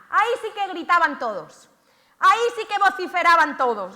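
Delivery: emphatic loud declaration; loud preaching. Acted. Preacher telling how all the lepers shouted, loud. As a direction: proclaim it to the hall. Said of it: "estoy teatralizando".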